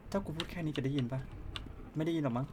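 testing a quiet voice. Real, not acted. (พูดเบาๆ ลองว่ามอนสเตอร์จะได้ยินไหม)

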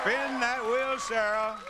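high, rousing host's call. Real, not acted. Host urging Sarah to spin the wheel, rousing call.

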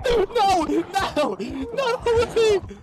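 high frantic no no no; panicking gamer. Real, not acted. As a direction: plead in panic. Zombies closing in.

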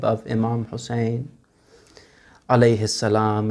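soft, low closing supplication; prayer. Real, not acted. Preacher leading the mourners' final prayer softly.

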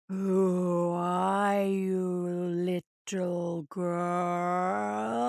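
low, slow, growling spirit voice. A narrator's reading. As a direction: growl it out like an ancient beast. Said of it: "The voice sounded like a growling hurricane".

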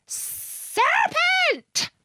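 very high, drawn-out screech; screamed. A narrator's reading. Pigeon attacks Alice among the treetops, screaming. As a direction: screech it like an alarmed bird. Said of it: "screamed the pigeon".

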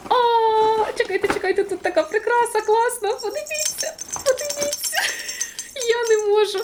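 high, squealing with delight; squeaky thrilled look at this; excited squeal. Real, not acted. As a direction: gush over the ornament. Excited over a pretty ornament.

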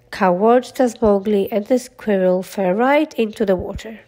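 ordinary delivery, nothing marked

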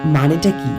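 usual speaking voice

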